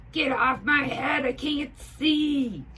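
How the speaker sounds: ball player yelling at the hat on his head. A narrator's reading. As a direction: yell in annoyance with a hat on your face. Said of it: "yells the player".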